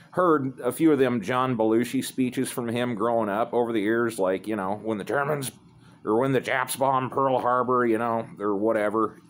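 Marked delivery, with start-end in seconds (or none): none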